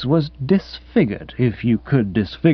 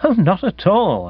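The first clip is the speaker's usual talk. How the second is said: airy, higher-pitched politeness; suave; 6 semitones higher than usual. A narrator's reading. Nephew reassuring his intruding aunt, suave. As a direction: answer with smooth, mannered courtesy. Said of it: "I replied with equal suavity".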